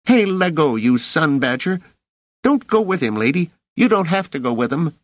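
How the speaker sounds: put-on high, squeaky boy's protest; urgent, high-pitched kid voice; struggling street kid. A narrator's reading. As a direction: plead and protest as a scrappy kid. Struggling boy protests, warns the lady.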